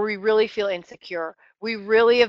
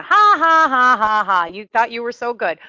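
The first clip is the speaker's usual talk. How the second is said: gloating enemy; 5 dB louder than usual. Acted. The enemy jeers at a fallen believer, gloating. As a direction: taunt them with a big fake ha-ha. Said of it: "then the enemy's gonna".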